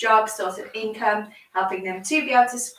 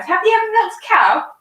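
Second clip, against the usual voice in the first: excited; 10 semitones higher, 6 dB louder, faster than usual. Real, not acted. Excited question to the child.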